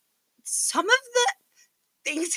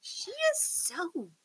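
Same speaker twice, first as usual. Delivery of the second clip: breathy hushed whisper; whispered aside; 4 semitones higher, 9 dB quieter, much slower than usual. Real, not acted. Sister whispering an aside about her snippiness. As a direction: lean in and whisper it. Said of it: "whispered aside about sister".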